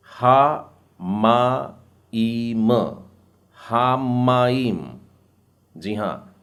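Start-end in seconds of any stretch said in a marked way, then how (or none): 0.1-2.7 हा मा ई म, very slow, one syllable at a time. Shown as a demonstration. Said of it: "अगला शब्द". 3.6-4.8 हामाईम said slowly; हामाईम drawn out; slow model word. Shown as a demonstration. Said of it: "म का उच्चारण भी दो बार".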